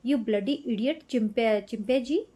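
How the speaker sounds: ordinary delivery, nothing marked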